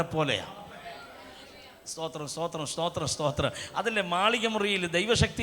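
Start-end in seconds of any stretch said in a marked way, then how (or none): 1.9-3.5 soft, low murmur of thanks; hushed prayerful murmur; murmured praise. Acted. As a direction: mutter the praise quietly to God. Praising God under his breath.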